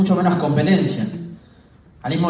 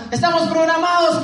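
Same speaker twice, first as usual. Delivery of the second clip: high, loud preacher-like shout of the Colombian speaker; 12 semitones higher, 4 dB louder than usual. Retold or imitated. Colombian speaker whipping up the crowd, shouting high. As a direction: belt it out like a fired-up seminar speaker. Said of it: "él gritando".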